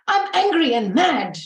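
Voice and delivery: mock angry. Shown as a demonstration. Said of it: "Like, I will say".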